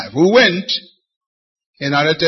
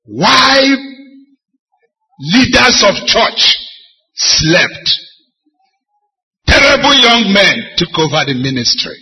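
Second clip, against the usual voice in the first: high, loud anguished preaching cry; preacher lamenting to the congregation; 6 semitones higher, 6 dB louder, slower than usual. Acted. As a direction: wail the question at the church.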